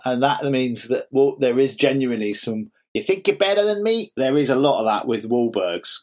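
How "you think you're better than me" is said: put-on lightly done Boston accent, confrontational; a hint of a Boston tough guy squaring up; mild Boston accent challenge; mock Boston tough guy. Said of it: "they're actual Bostonians".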